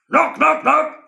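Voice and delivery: high, loud hammering knocks; loud knocking. Acted. Postman pounding the door, loud. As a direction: bang out each knock.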